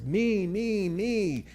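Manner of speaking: mock greedy kid shouting me, high; imitating grabby children clamoring for food; a playful hint of a clamoring child.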